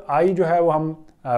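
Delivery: usual speaking voice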